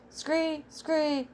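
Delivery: high, drawn-out frog cry; storybook mock scream, pitched well up; screamed frog screech. A narrator's reading.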